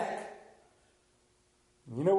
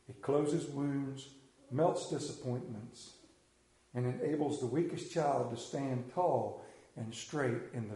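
solemn reading; 7 semitones lower, 5 dB quieter than usual. Acted. Preacher reading a creed to mothers, soft and solemn. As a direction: read the creed gently and reverently.

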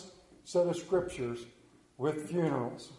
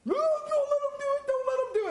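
put-on much higher, pleading child's voice; squeaky begging child; frightened kid. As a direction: beg like a scared little kid. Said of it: "kid begging at shot".

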